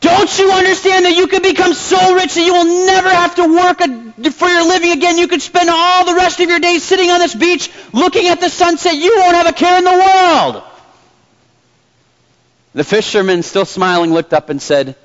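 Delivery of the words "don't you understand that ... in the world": very high, furious storybook shouting; acted angry tirade, pitched way up. A narrator's reading. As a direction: shout it as the businessman at the end of his rope. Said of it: "he started to shout".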